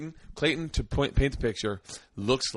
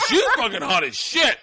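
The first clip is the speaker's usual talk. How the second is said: loud, high, laughing enthusiasm; excited vouching; 11 semitones higher, 8 dB louder than usual. Retold or imitated. Tom vouches for the porn star, loud and excited. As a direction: retell his excitement at full volume.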